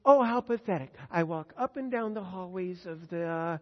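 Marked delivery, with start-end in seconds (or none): 0.0-0.9 loud mocking scorn; loud, higher mock disdain; mock pity. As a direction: sneer it out as the world would. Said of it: "You think".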